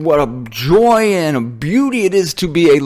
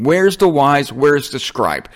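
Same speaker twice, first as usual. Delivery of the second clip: normal talking voice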